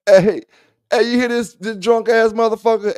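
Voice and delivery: loud, high and laughing; cracking up, loud and high; excited laughing call-out. Real, not acted. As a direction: burst out laughing at the caller. Laughing at the drunk caller.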